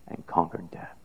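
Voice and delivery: soft, hushed whisper. Real, not acted. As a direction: drop to a whisper for the key truth. Quiet climax of sermon.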